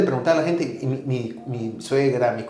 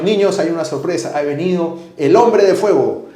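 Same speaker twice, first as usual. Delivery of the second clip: announcing a surprise; 4 semitones higher, 7 dB louder than usual. Retold or imitated.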